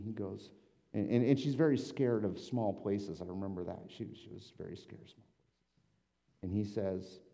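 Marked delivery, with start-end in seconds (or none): 3.9-6.4 breathy whispered aside, no voice. Real, not acted. Pastor recalls a sketch detail to the congregation, whispering. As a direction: whisper it like a secret. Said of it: "whispered repeat".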